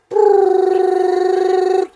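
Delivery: long, high, loud trilled ring; phone ringing. Retold or imitated. Imitates phone ringing on speaker.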